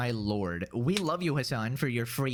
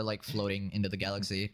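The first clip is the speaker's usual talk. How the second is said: usual speaking voice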